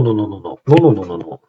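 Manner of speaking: ordinary talk, nothing marked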